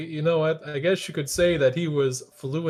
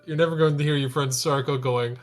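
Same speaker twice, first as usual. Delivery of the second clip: normal talking voice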